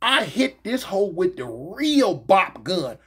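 ordinary talk, nothing marked

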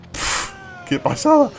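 high, slow wowed exclamation; suéltalo alucinado; amazed. Real, not acted. Amazed at the level. (asombro agudo y lento)

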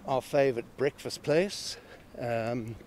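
ordinary talk

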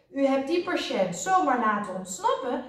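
high, indignant accusation; a director sternly scolding; angry. A narrator's reading. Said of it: "directeur boos". (boos verwijtend)

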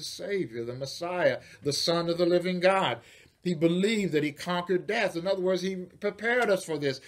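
preaching, building high and loud; rising preaching. Acted. Preacher proclaiming to the congregation, rising and loud. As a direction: lift the congregation, climbing in pitch.

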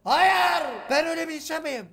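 high, loud indignant yell; offended bully shouting back at the girl; angry shout. Acted. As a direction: bellow the denial in outrage. Angry reply to insult.

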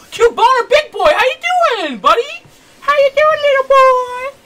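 very high, loud delighted squeal. Real, not acted. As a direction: squeal a greeting to a tiny pet. Greets the creature like a pet.

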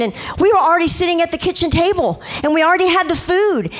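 ordinary talk

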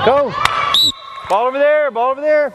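very high shout then high calls; calling out. Real, not acted. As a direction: call it across the court. Coach calling during the game.

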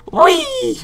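high, excited whee; gleeful squeal. Real, not acted. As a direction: squeal with delight on the way down. Diving down, playful cry.

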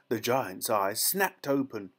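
ordinary delivery

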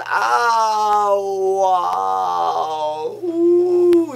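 high, loud melting ooh; swooning delight. Retold or imitated. After a tender night with the date, a hammed-up swooning cry. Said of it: "j'ai fondu, j'ai fait".

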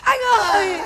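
loud, high theatrical wail; wailing call. Acted. Woman calling after the man who left her, theatrical wail. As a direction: call after him as if heartbroken, overdone.

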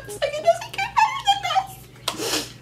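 high, giggly, bubbling over; laughing. Real, not acted. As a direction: laugh through the words with delight. Giddy over her blush.

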